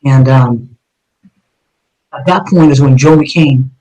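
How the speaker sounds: ordinary talk, nothing marked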